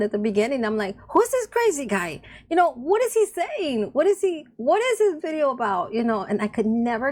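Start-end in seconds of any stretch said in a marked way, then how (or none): 1.1-5.9 higher, baffled questioning; mock bewilderment at a strange guy; imitating her old confused self; puzzled disbelief. Retelling early confusion about him.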